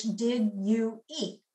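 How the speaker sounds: "Did you eat?" spoken slowly, three full syllables; careful standard English. Shown as a demonstration. Lecturer contrasting standard English with dialect, slow and careful. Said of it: "the standard English".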